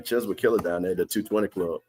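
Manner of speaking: ordinary delivery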